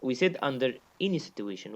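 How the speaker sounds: ordinary talk, nothing marked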